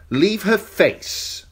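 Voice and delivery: slow cold command. A narrator's reading. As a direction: order it coldly, slowly. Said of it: "Joffrey commanded".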